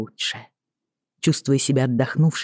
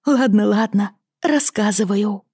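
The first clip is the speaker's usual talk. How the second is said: cartoon princess; 7 semitones higher than usual. A narrator's reading. Lizzie giggling, giving in.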